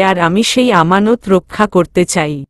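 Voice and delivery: ordinary delivery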